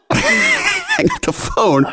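high, slow words through a laugh. Real, not acted. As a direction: laugh the line out. Laughing while retelling.

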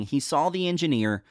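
usual speaking voice

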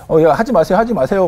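imitated rookie guard trying to break it up; flustered appeal. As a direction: call out urgently but politely. Rookie guard stopping shouting inmates.

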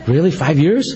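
mildly disbelieving, pitch rising on years; incredulous question. Retold or imitated. A startled listener checking with the leader, incredulous question. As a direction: question it as if you misheard.

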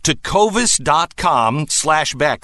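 lively, lifted sponsor pitch; radio ad read. Acted. Host welcoming a new boot sponsor, ad read. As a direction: sell it with energetic emphasis.

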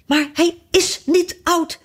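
very high, slow, stretched outrage; furious protest. A narrator's reading. Fiedeldij defends his rattle to Alice, furious and high. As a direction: wail it in mounting rage. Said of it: "riep Fiedeldij in grotere razernij dan ooit".

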